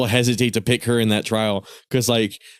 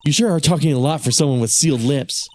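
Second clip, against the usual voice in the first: normal talking voice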